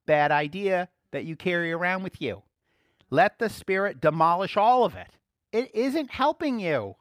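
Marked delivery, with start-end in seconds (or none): 3.1-7.0 forceful preaching. Acted.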